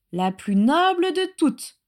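high, slow reverent elf voice; adoring creature voice; house-elf aside. A narrator's reading. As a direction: draw it out devoutly, pitched high. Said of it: "marmonna Créature pour lui-même".

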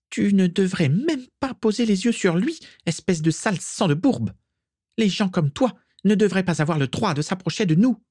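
high-pitched, contemptuous tirade; venomous scolding. A narrator's reading. Isalia putting Mimi in her place, venomous.

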